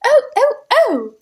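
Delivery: high gasps of laughter; gasping laughter. Acted. Jane, clutching her heart, gasping with laughter. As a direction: gasp for breath between laughs. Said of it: "cried Jane as she gasped".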